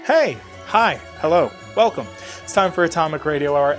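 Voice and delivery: ordinary talk, nothing marked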